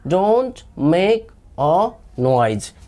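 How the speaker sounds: slow, every word separate; slow drill. Shown as a demonstration. Teacher answering "what do we say" for learners, slow drill. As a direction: model it slowly for repeating.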